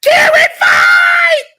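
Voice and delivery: long, high, loud hype shout. Real, not acted. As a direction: yell it out like a crowd at a fight. Hypes up the fight clip.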